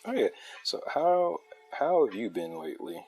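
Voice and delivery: ordinary talk, nothing marked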